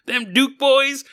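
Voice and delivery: high, slow Southern drawl; Dukes of Hazzard accent. Acted. Joking about Charlie's changed accent, Southern drawl. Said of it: "he's from the Dukes of Hazzard".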